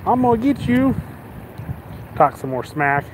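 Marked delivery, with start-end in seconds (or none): 0.1-0.9 mock drunk insult. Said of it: "He was like".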